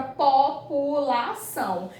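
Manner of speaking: "população" split into po, pu, la, ção; syllable by syllable. Shown as a demonstration. Teacher finishing the exercise, "população" in separate syllables. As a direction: measure out the four syllables of "população". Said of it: "Como é que é realizada a separação silábica".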